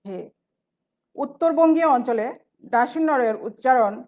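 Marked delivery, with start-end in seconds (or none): 0.1-0.2 হে said with an h in place of s; dialect h sound. Shown as a demonstration. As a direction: say হে, h replacing the s of সে. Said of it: "সেকে বলে".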